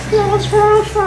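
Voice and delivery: mocking high fake-accent voice; mock fake American accent. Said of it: "I hate the fake accents".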